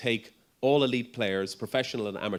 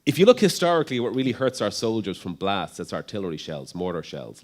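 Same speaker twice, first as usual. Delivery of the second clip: put-on American accent; 7 dB louder than usual. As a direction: quote the Marine helmet expert in an American accent. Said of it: "put on my American accent again for this".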